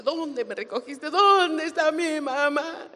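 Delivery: hammed-up sobbing plea, stretched out; mock tearful wail. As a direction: cry out like a dramatic kid. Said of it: "yo lloraba".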